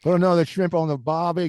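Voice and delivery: put-on overdone tourist Australian accent; Aussie accent, butchered for fun; mock Australian. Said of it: "we're butchering your accent".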